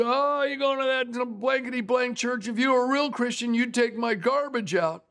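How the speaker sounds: high, mocking, slurred jeer at the passing pastor; drunk neighbour taunting. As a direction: sneer it like a tipsy heckler in his yard. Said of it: "he would taunt them".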